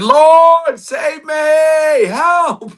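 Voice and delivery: very high, loud hammed-up shout for help; mock drowning cry, stretched out; panicked cry. Peter sinking in the water cries to the Lord, mock panicked shout. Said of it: "He started to have fear and doubt".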